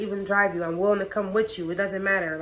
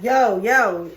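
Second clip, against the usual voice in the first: alarmed shout; 8 dB louder than usual. Retold or imitated. Car swerving toward tree.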